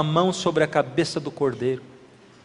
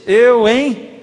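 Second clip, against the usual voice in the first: mock scoff; 4 semitones higher, 5 dB louder than usual. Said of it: "Você disse".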